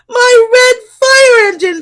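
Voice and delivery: high, loud alarmed shout. A narrator's reading. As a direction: wail it out in dismay. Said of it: "shouts Smoky".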